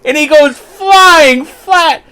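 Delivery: high, loud, slow words through laughter; laughing narration. Real, not acted. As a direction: tell it while cracking up. Telling the fall through laughter.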